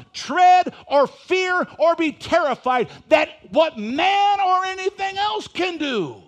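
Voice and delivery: loud, high-pitched pulpit proclamation; fervent preacher in full cry; full-force preaching. Acted. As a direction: build the verse to a ringing, forceful declaration.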